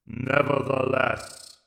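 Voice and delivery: slow, cold alien intoning; intoned. Acted. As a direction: intone it like a machine ruler. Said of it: "intoned the Emperor".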